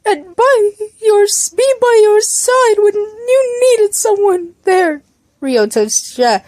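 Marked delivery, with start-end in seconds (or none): 0.0-5.0 high, drawn-out mock-sobbing wail; high-pitched, hammed-up crying voice; tearful apology. A narrator's reading. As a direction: whine it out slowly, as if breaking down. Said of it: "but his breath hitched".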